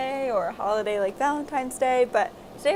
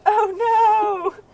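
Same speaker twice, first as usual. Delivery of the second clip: mock dismay; 11 semitones higher, 11 dB louder than usual. The matching sock joke.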